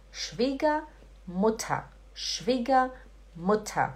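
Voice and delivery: Schwiegermutter slowly, as "shvee-gah-moo-tah"; both endings of Schwiegermutter open to "ah". Shown as a demonstration. Teacher models the word for learners, Schwiegermutter slow with "ah" endings. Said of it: "como se tivesse um A no lugar do E e do R".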